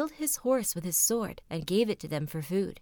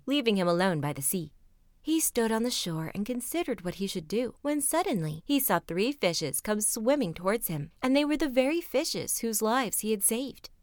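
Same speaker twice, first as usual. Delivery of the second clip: ordinary delivery, nothing marked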